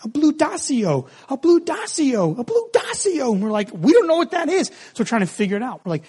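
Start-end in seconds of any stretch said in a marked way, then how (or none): 0.0-3.3 put-on high child voice, climbing with each repeat; insistent little boy. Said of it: "And he's like". 3.7-4.7 high-pitched bafflement, mock exasperation; a retold parent at a loss, pitched way up; exasperated parent. Baffled parents answering insistent child.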